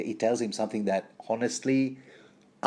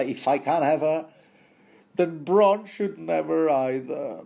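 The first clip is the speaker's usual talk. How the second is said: raised, louder jealous protest; mock petulant; 6 semitones higher, 4 dB louder than usual. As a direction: play the sulky possessive type, drawing out the end. Mimics possessive, jealous grumbling.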